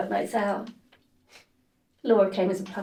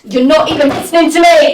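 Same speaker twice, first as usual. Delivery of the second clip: furious yell, much louder; angry shout; 7 semitones higher, 18 dB louder than usual. Acted. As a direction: scream it in frustration. Suspect explodes at interviewer.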